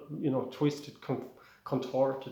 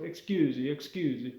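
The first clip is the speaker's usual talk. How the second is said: demonstrating a stock Italian accent. Shown as a demonstration. Showing the way into an Italian accent, Italian accent. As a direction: say it in cartoon Italian. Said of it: "that's the way you do an Italian accent".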